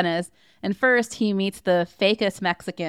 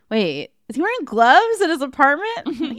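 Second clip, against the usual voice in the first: higher, incredulous question; mock bewilderment, pitched up; retold puzzled friend; 8 semitones higher, 4 dB louder than usual. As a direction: imitate a friend suddenly noticing something odd. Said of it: "she goes".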